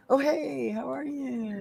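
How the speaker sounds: very high, sugary greeting; customer service voice. Retold or imitated. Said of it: "that customer service voice".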